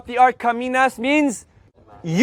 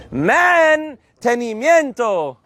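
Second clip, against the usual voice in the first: Mantenimiento said slowly, every syllable clear; slow model word; slower than usual. Shown as a demonstration. Teacher modeling for the class, slow and clear. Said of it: "teacher slow model".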